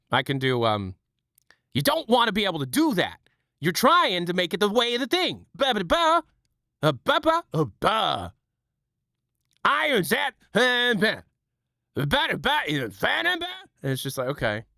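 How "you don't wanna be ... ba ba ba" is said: high, frantic babbling Tim Robinson voice; Tim Robinson impression. Streamer doing his Tim Robinson bit, high and frantic. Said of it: "right now I'm working on Tim Robinson".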